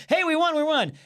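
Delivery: high-pitched mock victory cry; excited messenger. Marathon runner bringing news of the battle, excited. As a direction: announce the win in a high, eager voice.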